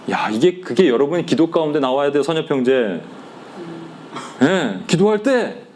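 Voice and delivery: ordinary delivery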